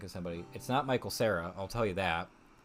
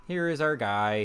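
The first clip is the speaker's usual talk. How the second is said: usual speaking voice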